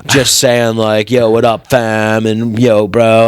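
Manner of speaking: low-pitched dude voice; mock bro. Bro describing himself to the judge, low mock bro voice. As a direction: do a low, dim frat-bro voice. Same bro candidate goes on.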